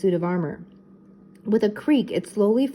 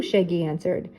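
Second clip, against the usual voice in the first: usual speaking voice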